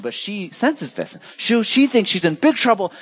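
ordinary talk, nothing marked

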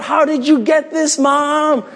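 put-on high, eager kid voice; a kid amazed at his present; excited kid. Preacher voicing a kid asking mom, high and excited.

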